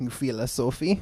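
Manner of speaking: put-on Russian accent. Podcast host sounding out a word, in a playful Russian accent. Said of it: "going back to my Russian accent".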